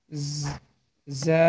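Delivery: isolated buzzed "জ-", then "জা" with a long vowel; cricket-like buzz. Shown as a demonstration. Teacher imitates the insect hum for learners, buzzing "জ-" into "জা". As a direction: buzz "জ-" alone, then open into "জা". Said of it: "জোনাকি পোকা ঝিঝি করে না, সেমন একটা শব্দ".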